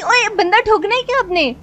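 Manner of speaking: alarmed yell. Acted. Wife scolds reckless driving.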